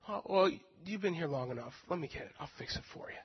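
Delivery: softening to a whisper; a kind boss easing the worker's worry; tender offer. Retold or imitated. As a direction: fade to a gentle hush. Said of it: "boss offers kindly".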